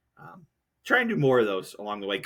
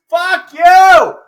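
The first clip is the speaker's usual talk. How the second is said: furious, much louder and higher yell; heated shout; 17 dB louder than usual. Real, not acted. Podcaster ranting at politicians over a raise, furious shout. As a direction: blow up and yell it. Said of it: "I didn't see myself getting that heated".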